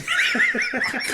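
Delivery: high burst of laughter. Real, not acted. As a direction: laugh out at the memory. Laughing at his story.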